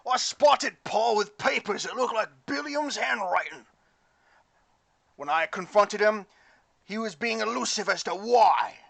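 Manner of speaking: put-on loud, high drunken rant. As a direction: rage like an angry drunk. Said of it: "through a drunk voice".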